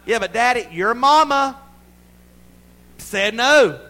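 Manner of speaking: loud, high mock parental outburst; exasperated shout. Father to a pestering child, exasperated shout. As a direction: shout it like a parent pushed too far.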